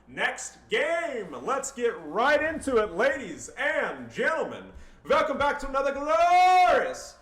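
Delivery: drawn-out, high, booming ring-announcer call; hyped announcer shout. Acted. Caster welcoming viewers to the next game, shouted announcer intro. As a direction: belt out a grand match introduction.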